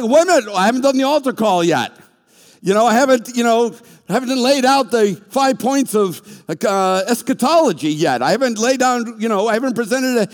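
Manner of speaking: loud, high-pitched mock indignation; flustered protest. Interrupted preacher objecting to God before the altar call, flustered and loud. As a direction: protest loudly, stumbling over your objections.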